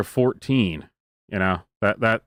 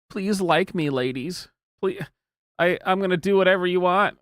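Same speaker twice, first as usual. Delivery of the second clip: high, pleading mock voice, a little higher; lightly mock a begging guy; mock simp plea; 7 semitones higher than usual. Host mocking a man begging ladies, high pleading voice. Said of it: "He's basically doing the".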